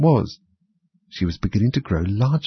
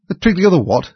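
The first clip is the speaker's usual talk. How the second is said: baffled; 9 semitones higher, 5 dB louder than usual. A narrator's reading. King baffled.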